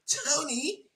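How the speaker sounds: high, soft mock-Cockney on the edge of laughter; giggly Cockney mimicry; Cockney accent. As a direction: imitate a Cockney, pitched well up. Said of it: "with this Cockney accent".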